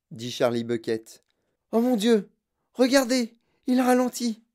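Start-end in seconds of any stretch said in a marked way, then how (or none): 1.7-4.4 high, loud gasp of dismay; raised, higher voice of alarm; alarmed exclamation. A narrator's reading. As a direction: cry out anxiously to the others. Onlooker alarmed at the boy's slowing.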